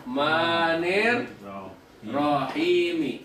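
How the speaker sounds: "Ar-Rahmanir Rahimi" slow, in separate drawn-out pieces; slow sounding-out. Shown as a demonstration. Teacher showing the kasroh ending, slowly. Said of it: "Ini kan dibaca kasroh".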